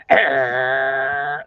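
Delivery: low playful growl. Pretend. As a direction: growl low like a little monster. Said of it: "You're gonna growl? Say".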